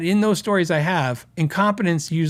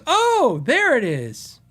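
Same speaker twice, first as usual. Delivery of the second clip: mock surprise; 5 semitones higher, much slower than usual. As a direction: play the caught customer acting surprised. Customer finds the power cord.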